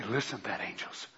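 very soft and low, almost under the breath; God hushed in awe. Acted. God marveling softly to the angels, hushed. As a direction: murmur it in quiet wonder. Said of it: "and say".

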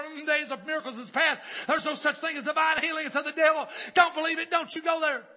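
loud, high imitation of naysaying churches; mock hollering. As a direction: holler the objections in rapid-fire phrases. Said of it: "could do is holler".

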